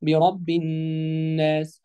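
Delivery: الناس with a long hummed nn; doubled ن. Shown as a demonstration. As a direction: stretch the ن of الناس very slowly. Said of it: "حرف النون المشدد".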